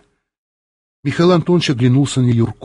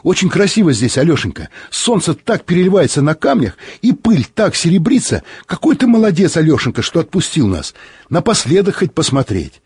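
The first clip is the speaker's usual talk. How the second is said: high, rapt admiration; overflowing with feeling; 6 semitones higher than usual. A narrator's reading. Pilot shares the beauty with his friend, rapturous. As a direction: gush over a beautiful view, pitched up. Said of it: "От полноты чувств он снова замолчал".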